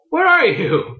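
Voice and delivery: high-pitched mock question; hammed-up, high bewilderment; mock bewildered cry. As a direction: imitate a baffled monster calling out. Voicing confused dragon in retold story.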